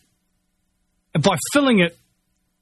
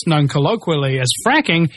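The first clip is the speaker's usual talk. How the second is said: ordinary talk, nothing marked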